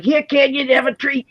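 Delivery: imitating a Boston friend offering a dog a treat; Boston accent. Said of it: "He talks like this".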